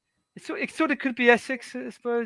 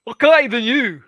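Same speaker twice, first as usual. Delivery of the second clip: louder, comic attempt at Scottish; Scottish accent; 3 semitones higher, 5 dB louder, slower than usual. Shown as a demonstration. Shows his only way into it, Scottish accent. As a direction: put on a rough Scottish accent. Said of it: "way to do a Scottish accent".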